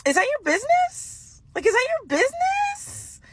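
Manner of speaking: high, slow, stretched-out mock outrage; indignant disbelief. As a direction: ask it like the question is absurd, drawn out and way up high. Indignant reaction to nosy shopper.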